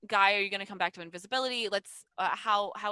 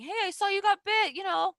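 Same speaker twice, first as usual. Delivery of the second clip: high, squeaky-pitched mystery voice; disembodied voice; 13 semitones higher, 5 dB louder than usual. Acted. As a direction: pitch it way up as an unseen speaker. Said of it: "You hear a disembodied voice saying".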